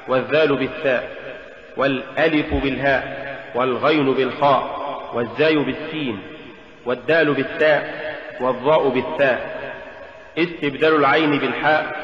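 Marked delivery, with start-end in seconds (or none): none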